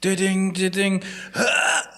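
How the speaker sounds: high mock voice crack; voice squeak. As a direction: squeak the top note out. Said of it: "have you had any squeaks".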